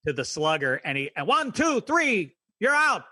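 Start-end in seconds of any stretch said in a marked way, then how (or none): 1.3-3.0 high, loud umpire calling the count. Retold or imitated. Recalling the cartoon umpire, loud high call. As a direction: bark out the strikes like an umpire.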